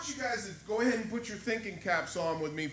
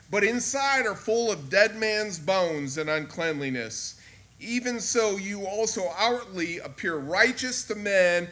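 louder, slow, ringing denunciation; loud prophetic rebuke; 13 dB louder, slower than usual. Acted. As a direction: proclaim each woe with slow force. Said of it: "He says".

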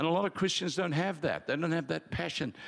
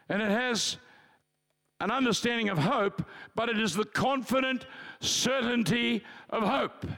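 high, ringing, impassioned preacher; preaching at full force; 7 semitones higher than usual. Acted. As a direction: drive the point home from the pulpit.

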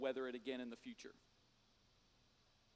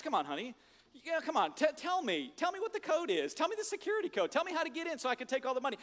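nagging wife; 7 semitones higher than usual. Retold or imitated. Wife voiced nagging for code.

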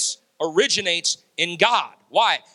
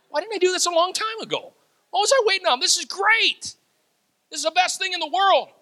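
much higher, delighted outburst; excited quote; 12 semitones higher than usual. Retold or imitated. Preacher quotes J P after his baptism, in a high delighted voice. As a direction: burst out high with delight at finally getting it. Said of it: "J P told me".

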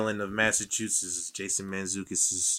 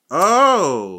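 loud, high burst of recognition; sudden realization; 9 semitones higher, 13 dB louder than usual. Real, not acted. Realizing who the friend means, a loud surprised oh. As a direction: it suddenly clicks, shout it out.

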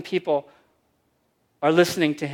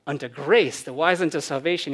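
usual speaking voice

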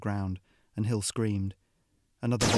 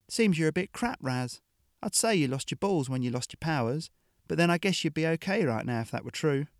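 mocking; 6 semitones higher than usual. Hill mocks the boy.

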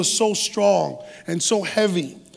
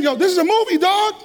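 incredulous; 8 semitones higher than usual. Retold or imitated. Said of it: "And I'm saying".

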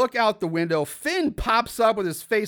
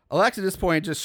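usual speaking voice

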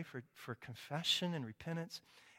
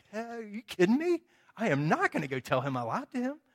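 protesting; 6 semitones higher, 7 dB louder than usual. Retold or imitated. Protesting to Mark.

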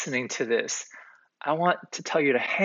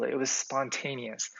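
ordinary delivery

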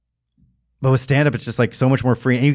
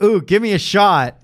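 greedy glee; 7 semitones higher, slower than usual. Retold or imitated. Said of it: "I was like".

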